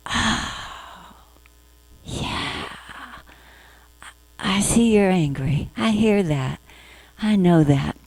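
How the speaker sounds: soft, hushed, caring mommy voice; tender soothing mother. Retold or imitated. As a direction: comfort it like a mother hushing a child. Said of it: "the mindfulness mommy needs to be there and say".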